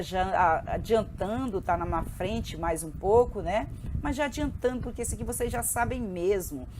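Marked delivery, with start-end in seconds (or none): none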